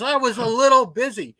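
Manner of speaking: hammed-up old man shrugging it off; dry old man.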